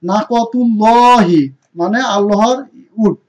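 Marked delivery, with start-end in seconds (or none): 0.1-1.4 নাকতুলহি drawn out slowly, heavy লাম; slow Quran recitation. Shown as a demonstration. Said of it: "তাহলে কি হবে?". (ধীরে টেনে তিলাওয়াত)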